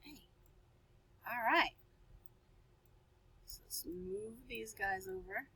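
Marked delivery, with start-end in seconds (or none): 3.5-5.5 soft aside to self; whispered aside. Real, not acted. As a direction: whisper while moving things. Aside while moving pictures.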